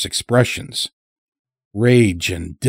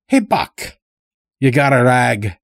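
higher-pitched folksy call; lightly voiced old farmer; 4 semitones higher than usual. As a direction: lightly play a rustic old-timer calling over. Old captive calls to his captor.

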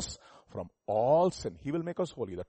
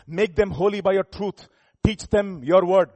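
loud, raised preaching voice; emphatic scripture reading; 4 semitones higher, 8 dB louder, slower than usual. Acted. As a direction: proclaim each phrase firmly. Preacher reads verse emphatically to congregation.

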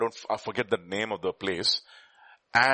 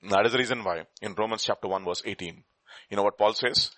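ordinary talk

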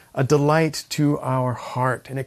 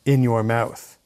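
loud, raised scripture reading; 朗々とした聖書朗読; 3 semitones higher, 4 dB louder than usual. Acted. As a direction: proclaim God's commission forcefully, easing off at the end. Reads scripture aloud with force.

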